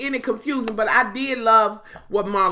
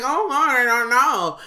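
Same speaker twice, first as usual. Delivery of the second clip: mock victim; 2 semitones higher, 3 dB louder, slower than usual. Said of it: "acting like you was the victim".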